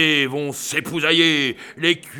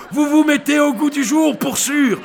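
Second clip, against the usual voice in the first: high fast nervous flattery; 10 semitones higher, 5 dB louder, faster than usual. Acted. Crier nervously flatters armed crowd.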